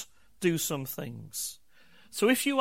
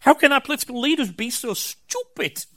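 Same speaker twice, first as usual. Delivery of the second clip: higher, louder exasperated rant at leaders; hammed-up armchair outrage, louder and higher; mock political rant; 6 semitones higher, 5 dB louder than usual. Pastor mocking his own day-off ranting at politicians, louder and higher. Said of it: "I end up being like".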